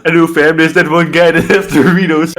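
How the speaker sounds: eager loud chatter; excited agreement. Real, not acted. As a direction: jump in loudly with enthusiasm.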